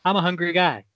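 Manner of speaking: ordinary talk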